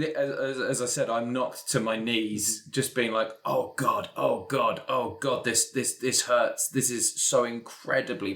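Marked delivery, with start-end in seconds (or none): none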